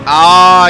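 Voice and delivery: a sudden loud ah; loud exclamation. Real, not acted. Streamer blurts out at his race, a loud ah. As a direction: blurt it out at full volume.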